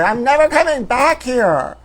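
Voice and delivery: high, slow furious yell; screamed. Retold or imitated. Yelling at the old man and mother, slow furious yell. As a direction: storm out yelling the words. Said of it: "I scream at both of them".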